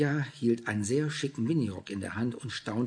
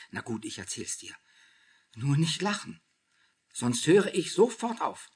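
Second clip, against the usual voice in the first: agitated confession; 5 semitones higher, 4 dB louder than usual. A narrator's reading. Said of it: "packte sie erregt am Ärmel".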